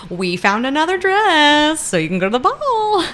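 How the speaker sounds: high, bright excited chorus of maids. Retold or imitated. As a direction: gush it out like eager maids.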